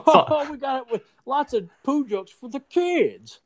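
very high put-on silly voice; hammed-up high-pitched mock pitch; mocking cartoon makers. Mocks cartoon makers pitching poop jokes.

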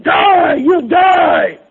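high, full-voiced yell of fury; loud, high-pitched mock battle cry; shouting Rampage. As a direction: imitate a fighter screaming as he punches. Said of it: "Rampage was punching that thing and going".

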